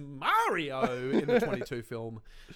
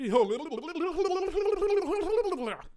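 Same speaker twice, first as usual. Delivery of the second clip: high, rapid babbling tape gibberish; fast-forward sound effect; 13 semitones higher, 4 dB louder than usual. Pretend. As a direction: imitate a tape fast-forwarding, tongue rattling. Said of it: "what'd you think of that fast forward sound effect".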